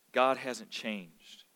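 ordinary talk

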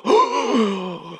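a pretend sharp gasp of shock. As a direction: gasp as a scandalised onlooker. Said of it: "we would go".

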